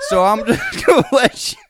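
laughing through the words; cracking up mid-line; breaking into laughter. Real, not acted. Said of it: "transforming into some".

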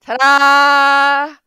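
loud, high, drawn-out ta-da; triumphant fanfare. Real, not acted. Celebrating being right about ballet, loud triumphant cry. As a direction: belt it out like a big reveal.

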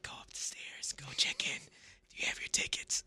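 soft, voiceless mock whisper; imitated staff whisper. Staff directing concertgoers upstairs, imitated whisper. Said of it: "they're all whispering".

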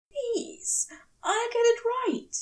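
high, desperate plea; begging. A narrator's reading. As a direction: beg him not to leave. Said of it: "he begs".

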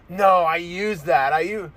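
slow, dragged-out whiny refusal; a hint of a petty ex, slowed down; mocking ex voice. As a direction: drawl it like a petty ex. Ex refusing, mocked.

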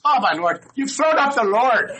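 high, loud, laughing mother voice; shocked mother. Retold or imitated. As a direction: squeal it as the horrified mother, through laughter. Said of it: "And his mother says".

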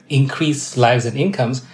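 ordinary delivery

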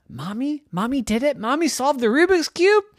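high, rising disbelief; incredulous kids. Acted. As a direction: question it with growing astonishment. Kids incredulous Mommy solved it.

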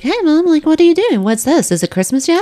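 put-on high, eager little kid. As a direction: pester like an excited child. Mom voicing her kids' questions.

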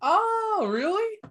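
very high, amazed "really"; genuinely taken aback; surprised. Real, not acted. Surprised by Hungarian singers.

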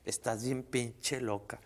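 drawn out slow, each word weighed; mock-stern slow verdict; slow fond scolding. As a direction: tease her slowly, lovingly. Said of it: "Me acuerdo que le dije".

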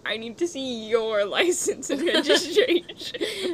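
mock cop line cracking up; laughing reversal. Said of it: "they pull out an Uno reverse card and they're like".

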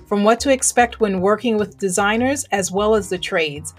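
host opening her show over music; podcast intro read. Acted. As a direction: welcome listeners like a radio host.

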